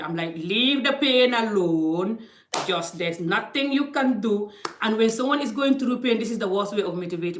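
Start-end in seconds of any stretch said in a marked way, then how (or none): 0.4-4.5 blunt African-style motivating. Retold or imitated. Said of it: "I'm very African the way I motivate".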